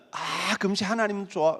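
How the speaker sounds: usual speaking voice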